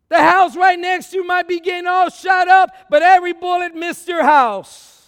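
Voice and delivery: loud, high, shouted preaching; full-force preaching. Acted.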